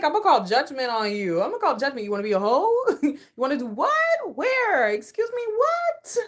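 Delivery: loud, high-pitched incredulity; mock outrage. Acting out the partner hearing you stay casual, mock outrage. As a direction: act scandalised, voice climbing to a squeal.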